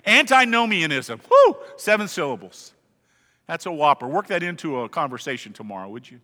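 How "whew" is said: impressed whistle. Real, not acted. Reacting to a long word.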